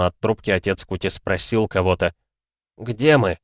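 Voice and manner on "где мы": ordinary delivery, nothing marked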